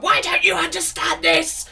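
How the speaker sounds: high, loud mock shout; hammed-up frustrated teacher losing patience; stressed exasperation. Impatient teacher to a struggling knitter, mock stressed shout. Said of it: "I get sh-, really stressed".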